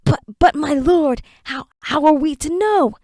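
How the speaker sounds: high, stammering plea; cringing stammer. Acted. Servant Nabat stammering excuses to his lord. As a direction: grovel before the master.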